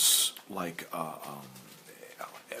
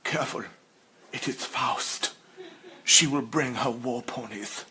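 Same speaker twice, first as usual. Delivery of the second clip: villain voice; 2 semitones higher than usual. Retold or imitated. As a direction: warn them like a cartoon villain. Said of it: "she also sounds like a villain".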